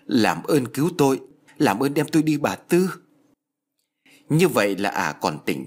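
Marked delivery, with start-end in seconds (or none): 0.1-2.9 higher-pitched, imploring plea; entreating voice, pitched up; pleading. Acted. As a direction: beg desperately to be rescued. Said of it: "vừa cất giọng van lơn".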